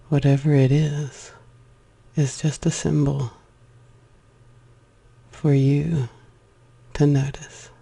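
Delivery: unhurried meditation guide; guided meditation. Acted. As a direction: lead a breathing meditation, letting each phrase settle. Leads a breathing meditation.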